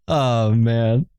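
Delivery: ordinary talk, nothing marked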